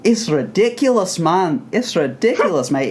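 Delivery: high-pitched, over-the-top Scottish imitation; exaggerated Scottish brogue; mock Scottish accent. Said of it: "roll out the Scottish accent".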